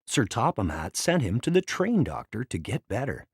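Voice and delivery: ordinary delivery, nothing marked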